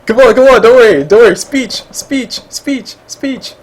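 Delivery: eager chant of speech, speech; cheering him on. Real, not acted. Host urging guest to speak.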